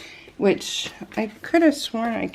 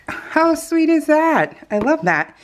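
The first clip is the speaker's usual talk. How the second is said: delighted; 8 semitones higher, slower than usual. Real, not acted. Delighted by matchbook sticker.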